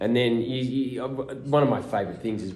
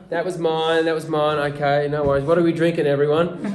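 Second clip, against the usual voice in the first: louder, higher cheerful owning up; good-humoured admission; 6 semitones higher, 5 dB louder than usual. Retold or imitated. Actor admitting the phone was his to the crew, cheery and loud. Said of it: "and you go".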